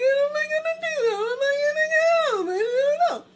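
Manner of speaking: high, loud sobbing words; crying. Retold or imitated. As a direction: wail it through tears to your sister. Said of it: "I started to cry".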